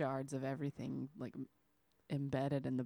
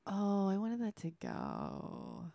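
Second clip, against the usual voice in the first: slow, airy, put-upon customer; whiny customer; 4 semitones higher, 4 dB quieter, much slower than usual. Retold or imitated. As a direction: play the clueless customer. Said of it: "they're like".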